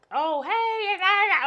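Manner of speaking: high, loud, gushing mock greeting; mock happy-go-lucky. Woman showing friend the bubbly person she isn't, mock perky. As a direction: squeal it like an over-bubbly person. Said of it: "like a happy-go-lucky person".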